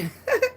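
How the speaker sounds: a sudden high squeal of a laugh; high burst of laughter. Real, not acted. Vlogger laughing at a comment telling her to go to church, high laugh.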